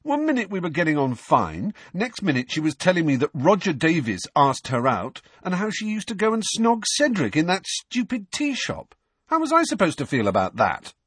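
high-pitched, indignant rant; aggrieved, outraged protest. A narrator's reading. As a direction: pour out the grievance, pitch riding high.

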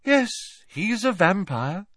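high-voiced, untroubled certainty; matter-of-fact. A narrator's reading. Luna telling Harry about the minister, matter-of-fact. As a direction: state it as simple fact. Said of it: "said Luna matter-of-factly".

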